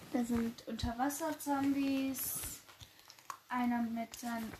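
normal talking voice

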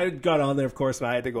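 ordinary talk, nothing marked

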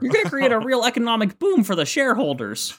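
high pushy salesman pitch; hard sell ad. Retold or imitated. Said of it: "Ash's Pidgeotto".

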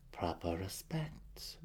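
soft, slow mutter; muttered under breath. A narrator's reading. Matron mutters at her overstepping son. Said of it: "Malice muttered under her breath".

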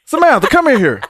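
high, loud summons to a colleague; mock calling out. Doctor calling Samantha in for a quick question, mock holler. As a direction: holler for someone down the hall, playfully.

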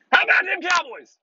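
high, loud hammed-up fan cheer; Cowboys fan cheer. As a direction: belt it like a rowdy Texan fan. Obligatory Cowboys catchphrase.

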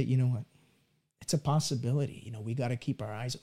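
low, soft and quick, half under the breath; hushed worried aside. Retold or imitated. Doubting parent murmuring to spouse, hushed and low. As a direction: murmur it to your spouse like a private worry.

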